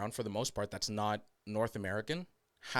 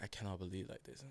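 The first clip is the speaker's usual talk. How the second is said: put-on very soft accented English; hushed imitation of an immigrant accent; imitated immigrant accent; 3 semitones lower, 14 dB quieter, faster than usual. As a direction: murmur it as an inner thought, in an immigrant accent. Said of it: "saying that in her head".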